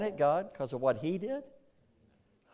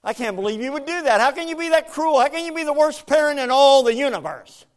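high, loud, fast indignant complaint; angry at God; 12 semitones higher, 9 dB louder, faster than usual. Retold or imitated. Angry believers to God, high loud fast indignant rant. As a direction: rant at God like a wronged child. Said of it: "They go like this".

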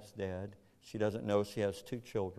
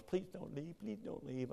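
pleading wife; 8 semitones higher, 8 dB quieter than usual. Retold or imitated.

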